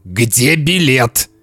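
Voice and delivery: slow, looming demand; menacing father. Acted. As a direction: stretch it out as a threat. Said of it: "надвигаясь на Сеню".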